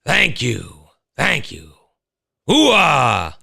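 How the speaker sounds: showy actor impression with a big hooah; Al Pacino. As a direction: play the famous actor taking a bow. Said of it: "I'll be working on the Pacino".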